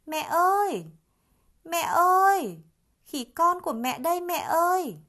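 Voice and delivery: high, loud shout to the distance; calling through cupped hands. A narrator's reading. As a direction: shout far across the forest, hands cupped. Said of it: "đưa tay lên miệng làm loa gọi". (khỉ con khum tay gọi mẹ từ trên cây, gọi to)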